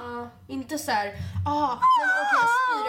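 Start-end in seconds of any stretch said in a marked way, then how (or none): 1.5-2.9 very high, loud wail; hammed-up shriek of a sick passenger; mock alarmed outcry. Mocks passenger announcing he is vomiting.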